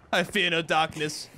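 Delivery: bolder take in mid pitch. Acted. Gamer declaring bravery before throwing a grenade, mid-pitched declaration. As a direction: declare it at normal speaking pitch. Hero line repeated higher.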